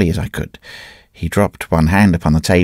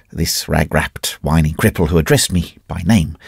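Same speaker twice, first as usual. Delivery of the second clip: plain reading voice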